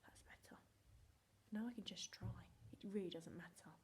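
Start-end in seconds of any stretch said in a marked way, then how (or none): 0.0-0.6 calm whispered self-talk; inner whisper. Retold or imitated. Teacher voicing her calm inner thoughts, whispered. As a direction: whisper to yourself to settle. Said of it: "and I think".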